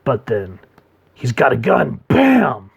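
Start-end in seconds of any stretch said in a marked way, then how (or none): 2.1-2.7 loud, high bam; shouted gunshot imitation. Narrator mimicking a gun going off, shouted. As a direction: burst out a gun noise.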